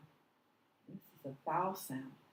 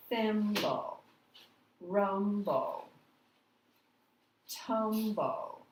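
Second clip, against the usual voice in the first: Thimble, rumble, tumble, each first syllable longer and higher; stress on first syllable; 4 semitones higher, 4 dB louder, much slower than usual. Shown as a demonstration. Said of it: "the first syllable is said a, a little longer".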